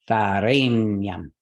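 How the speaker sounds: "Varenyam" slow, stress on the middle syllable ren. Shown as a demonstration. Teacher showing where stress falls, stress on ren. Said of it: "Rain is heavy. So that will take the stress".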